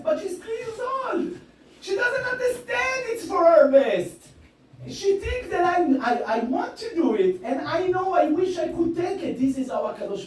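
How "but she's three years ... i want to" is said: worked up. Real, not acted. Agitated about his daughter's pain.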